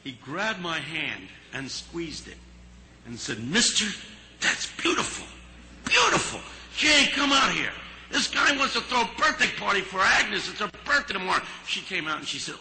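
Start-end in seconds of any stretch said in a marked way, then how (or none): 3.4-11.4 much higher, breaking into a cry, overcome; a tough cook suddenly moved to tears; choked-up Harry. Retold or imitated. Harry moved, nearly crying.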